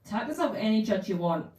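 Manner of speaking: plain reading voice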